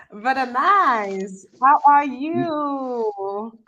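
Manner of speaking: very slow, stretched hello; drawn-out greeting. Real, not acted. Host hailing a guest who just joined, long drawn-out greeting. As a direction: draw each word right out in welcome.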